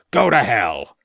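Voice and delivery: a loud furious farmer's shout. A narrator's reading. Farmer shouting at the inspector to go away. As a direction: bellow it in his face. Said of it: "shouted Troutbridge".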